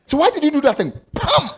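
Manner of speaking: put-on high, loud parent scolding a child; mock scolding a child. Preacher acting a parent scolding a child, high and loud. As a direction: snap at the child like an angry parent.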